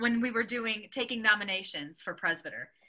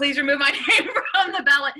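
louder, laughing through the quote; laughing mock dad; 4 semitones higher, 7 dB louder than usual. Retelling the dad pulling his name, laughing. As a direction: quote dad's protest while laughing. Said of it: "and my dad".

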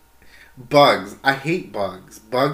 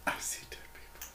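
breathy ghostly whisper of the movie line; creepy whisper; 18 dB quieter, faster than usual. Pretend. Friend whispering the creepy bird's line to her friend, eerie whisper. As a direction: hush it like a spooky child.

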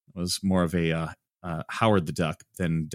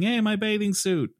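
high cartoon duck voice; Donald Duck; 12 semitones higher than usual. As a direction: squawk it like a panicked duck. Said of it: "Donald is running across the water saying".

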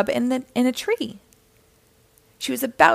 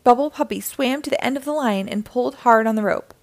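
plain reading voice

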